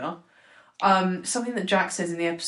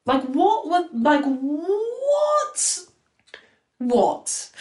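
much higher, a baffled cry of "what?"; incredulous cry; 8 semitones higher, much slower than usual. Real, not acted. Host baffled at what the writers were thinking, incredulous cry. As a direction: sound genuinely bewildered.

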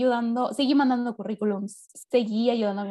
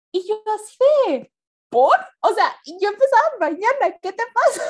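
shocked; 9 semitones higher, 6 dB louder than usual. Retold or imitated. Retelling her shocked reaction.

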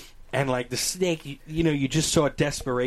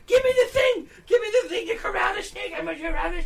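very high, frantic silly squeal; Rob's stupid voice; faster than usual. Retold or imitated. Storyteller mimics his friend wanting a snake hook, high silly voice. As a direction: demand it in a mocking squeaky friend voice. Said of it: "And Rob is like".

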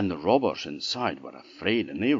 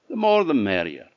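cheerful; 4 semitones higher, 6 dB louder than usual. Acted. Chak answers cheerfully.

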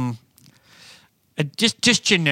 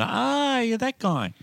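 very high, drawn-out "Ah"; imitated fan recognition; 12 semitones higher, much slower than usual. Comic imitates people recognising him with a smile, high-pitched.

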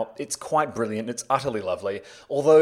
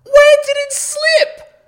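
very high, loud incredulous rant; losing it at a hopeless co-star; exasperated yelling; 11 dB louder than usual. Acted. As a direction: shriek in outraged disbelief. Exasperated quarrel over the slipped accent.

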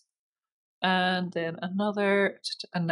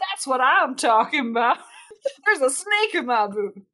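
higher, hammed-up cowboy accent with laughter; 6 semitones higher, 5 dB louder than usual. Game master quoting cowboy lines, jokey cowboy accent. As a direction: play a toy cowboy, badly. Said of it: "I can't do a fucking cowboy accent".

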